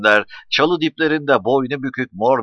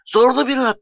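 high-pitched breathless exclamation; lightly acted boy calling out, winded; breathless shout; 13 semitones higher, 4 dB louder than usual. A narrator's reading. Nuri arrives on horseback from below, breathless shout. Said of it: "diye bağırdı Nuri soluk soluğa".

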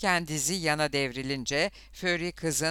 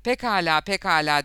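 loud, higher-pitched surrender; giving in; 3 semitones higher, 7 dB louder than usual. A narrator's reading. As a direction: concede hastily and loudly. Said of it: "diye teslim oldu şoför". (teslim olurcasına)

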